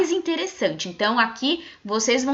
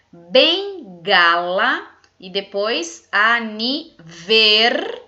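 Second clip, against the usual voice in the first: slow dictation; 5 dB louder, much slower than usual. Shown as a demonstration. Teacher reading the missing syllables: slow loud dictation. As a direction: read the words slowly and louder. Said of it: "Então eu vou ler".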